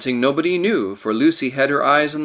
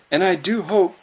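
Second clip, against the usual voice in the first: higher, a touch trembling; tremulous; 7 semitones higher than usual. A narrator's reading. Lucy begging the others to come, tremulous. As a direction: plead with a catch in it. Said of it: "in a tremulous voice".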